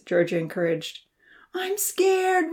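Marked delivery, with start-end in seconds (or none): 1.5-2.5 very high, frightened plea; pleading. A narrator's reading. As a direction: plead with her friends out of fear. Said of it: "Rosa pleaded".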